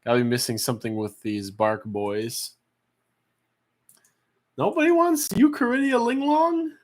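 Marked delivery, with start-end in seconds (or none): 4.6-6.7 high, fast, astonished plea; mock disbelief. Real, not acted. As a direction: act shocked nobody bids. Pleading for bids.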